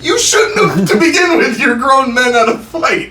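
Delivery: high, loud exasperated outburst; indignant rant. Real, not acted. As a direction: scold them like grown fools. Ranting at the fighters.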